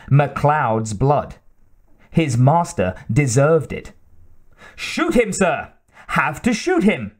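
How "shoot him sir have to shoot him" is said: high, insistent servant's urging; agitated. A narrator's reading. Servant wanting MacLeod's blood, urging his master, agitated. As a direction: demand it excitedly, pitch jumping up. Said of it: "He was thoroughly agitated by this time".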